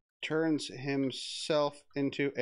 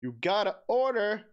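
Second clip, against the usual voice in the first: raised calling voice; 5 semitones higher than usual. Pretend. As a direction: shout across the store. Calling to rats.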